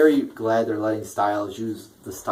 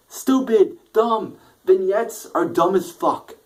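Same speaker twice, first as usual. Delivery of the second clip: angry rant; 8 semitones higher, 4 dB louder, slower than usual. Real, not acted. Ranting about wrestling vignettes.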